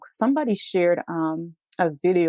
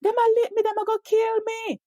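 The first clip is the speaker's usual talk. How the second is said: much higher, desperate pleading in patois; retold terrified cry. Man shoved into police car, high terrified pleading. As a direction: wail it like a man being beaten. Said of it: "he said".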